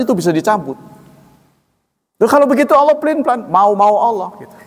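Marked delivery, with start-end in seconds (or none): 2.2-4.6 mock indignant protest. Mock objection from listener.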